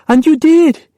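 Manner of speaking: put-on high, tearful child's voice; girl crying to her mother. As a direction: cry it out to mother. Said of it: "she cried".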